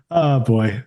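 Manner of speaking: usual speaking voice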